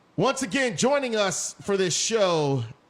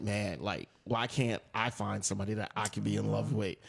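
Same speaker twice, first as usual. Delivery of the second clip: quiet; 9 semitones lower, 8 dB quieter than usual. Retold or imitated. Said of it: "I'm like".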